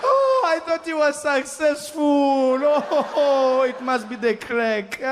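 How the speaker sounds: high, slow, wailing grandmother. Retold or imitated. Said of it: "She'd be like".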